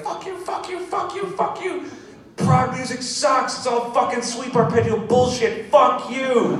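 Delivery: high, loud mock scream at the crowd; retold stage rant. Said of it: "I was just like".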